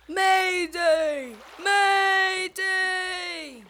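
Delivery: high comical distress call; comical cry. Acted. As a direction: cry out in a funny panicky voice. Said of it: "a comical voice cry".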